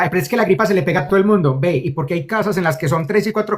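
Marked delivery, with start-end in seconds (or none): 0.0-1.6 mock objection. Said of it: "la gente diría".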